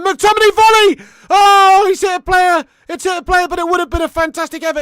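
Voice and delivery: loud high live commentary call; rising then easing match call; excited commentary. Real, not acted.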